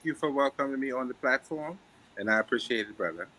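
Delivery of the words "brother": ordinary delivery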